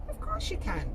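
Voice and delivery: high, loud, brushing it aside; won't hear a word against it; dismissive insistence. A narrator's reading. Matthew overriding Lally's refusal, loud and insistent.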